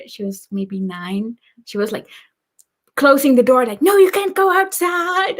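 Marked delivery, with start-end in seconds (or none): 3.8-5.4 high-pitched mock protest; alarmed big sister. Big sister stopping toddler at the door, mock alarm. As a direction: retell a child's urgent objection, pitched up.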